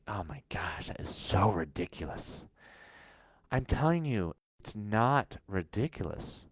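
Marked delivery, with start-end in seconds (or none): 0.1-2.5 low, hushed whisper of embarrassment; a low whispered aside to yourself; ashamed whisper. Retold or imitated. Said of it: "You're like".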